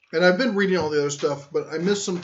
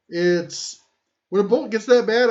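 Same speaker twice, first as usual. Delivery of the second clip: ordinary delivery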